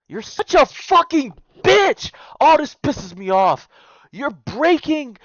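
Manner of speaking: high, loud, slow furious yell. Real, not acted. Yelling at the lying brother from the post, furious shout. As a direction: scream it straight at the liar.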